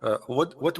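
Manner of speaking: ordinary delivery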